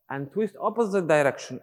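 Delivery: ordinary talk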